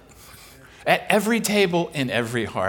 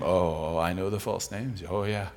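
low, soft acted reply; subdued admission; 11 semitones lower, 7 dB quieter than usual. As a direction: admit it quietly, as the student. Student admitting he knows false names.

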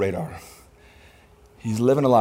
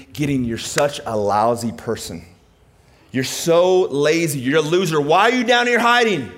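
harsh scolding; 3 semitones higher, 4 dB louder than usual. Acted. Mock angel berating Gideon in his hiding place, scolding. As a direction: berate him, slower at first, rising to a shout. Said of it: "God never speaks like that".

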